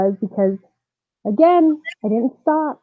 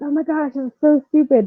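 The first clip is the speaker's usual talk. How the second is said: acted-out muttering to oneself, higher pitch; retold self-scolding, pitched up; mildly exasperated with yourself; embarrassed self-talk; 6 semitones higher than usual. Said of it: "talking to myself".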